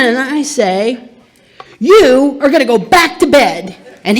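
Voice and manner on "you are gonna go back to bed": loud, firm command; firm loud order. Retold or imitated. Daughter ordering her dad back to bed, loud and firm. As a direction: tell him firmly, raised voice.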